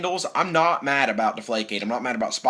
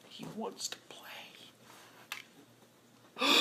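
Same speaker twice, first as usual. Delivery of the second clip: unvoiced whisper, much softer; 9 semitones higher, 19 dB quieter than usual. Fan watching Luck beg to play, whispering. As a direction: breathe it out in reverent awe.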